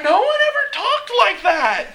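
high-pitched, hammed-up astonishment; mock awe. Hired men back from hearing Jesus, explaining in mock awe. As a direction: play it stunned, voice pushed way up.

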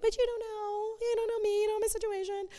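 high, soft whiny protest; whiny defiant teen. Retold or imitated. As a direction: sulk like a misunderstood teen. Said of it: "I'm sure I'd be like".

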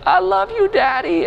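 put-on very high, tiny little-girl voice; toddler's sweet thanks. Dad voicing his daughter thanking him, very high little-girl voice. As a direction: say it like a hugging three-year-old. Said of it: "she gives me a big hug".

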